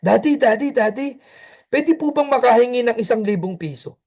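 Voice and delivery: a hint of a wheedling kid; nagging child. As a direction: pester dad sweetly, over and over. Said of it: "Nangulit-ulit yung bata".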